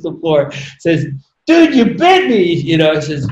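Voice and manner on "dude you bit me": high, loud, put-on voice of the bitten man; mock outrage. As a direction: cry out in mock outrage at the snake. Bitten man protests in story.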